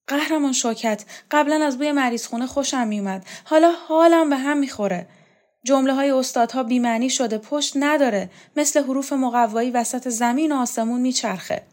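high-pitched, distressed lament; plaintive complaint. A narrator's reading. Borzu confides to Showkat that everything sickens him, plaintive.

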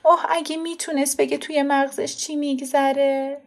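high, near-tearful wondering; high lament for the child; choked-up mother. Acted. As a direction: voice lifting with held-back tears. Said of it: "مادر بغض کرد و گفت".

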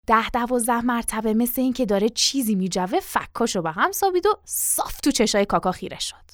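ordinary delivery

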